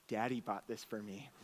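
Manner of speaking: whisper-proud of a gift; proud child murmur. Retold or imitated. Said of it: "she said".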